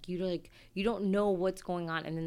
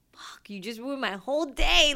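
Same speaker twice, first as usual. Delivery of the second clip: louder, higher, annoyed snap of a retold curse; mock outburst; 6 semitones higher, 8 dB louder than usual. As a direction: blurt it out like your day is ruined. Someone triggers you, acted outburst.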